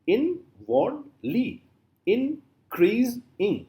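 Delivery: "inwardly, increasing" very slowly, three separate beats each; split into syllables. Shown as a demonstration. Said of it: "comprising three syllables".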